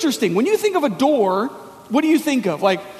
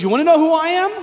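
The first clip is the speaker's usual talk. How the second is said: bold challenge; 5 semitones higher, 3 dB louder, much faster than usual. Retold or imitated. Jesus answering the challengers.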